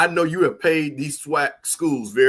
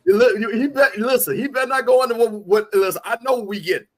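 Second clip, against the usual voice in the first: excited protest; 8 semitones higher, 6 dB louder than usual. Real, not acted. Insists host not reveal deal.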